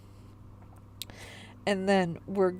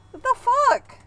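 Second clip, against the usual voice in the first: much higher, louder shocked exclamation; high startled outburst; 8 dB louder than usual. Acted. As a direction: blurt it out in disbelief. Shocked reaction retaken higher.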